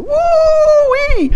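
high whooping woo-wee; gleeful yelp of praise; joyful woo-wee. Pretend. Exultant whoop in pretend prayer.

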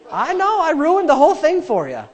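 high, teasing mock lament; mock sympathy. Preacher teasing about texting rule, mock sympathy. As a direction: tease them with exaggerated commiseration.

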